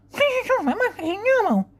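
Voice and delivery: very high, indistinct indignant voice; cleft palate impression. Lady answering someone asking about her palate, high cleft-palate impression. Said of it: "And she'd be like".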